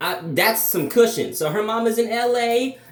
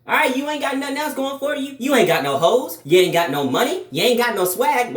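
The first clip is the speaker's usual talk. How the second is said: higher, pushy devil on your shoulder egging you on; a hyped-up little devil, mock scolding; 6 semitones higher than usual. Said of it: "the bad side ... he was talking about".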